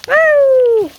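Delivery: high, loud whoop; whooping cheer. Real, not acted. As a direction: let out a joyful whoop. Cheering on the quad.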